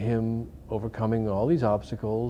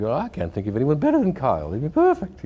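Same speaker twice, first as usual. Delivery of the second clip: a bad, hammed-up Australian accent, higher and louder; Bruce's Australian accent; 6 semitones higher, 7 dB louder than usual. Australian accent, imitating the director raving about Kyle. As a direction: gush in a mock Australian accent, climbing high on perfect. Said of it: "my bad attempt at Bruce's accent".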